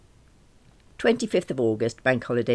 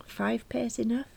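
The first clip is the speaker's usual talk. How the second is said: whispered aside; 10 semitones higher, 5 dB quieter than usual. Acted. Mother musing over shopping list.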